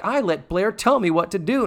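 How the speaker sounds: high bratty mock complaint; shittily. Girl griping about taking orders, mock bratty. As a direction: imitate a put-upon schoolgirl, voice lifted. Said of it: "shittily, I will add".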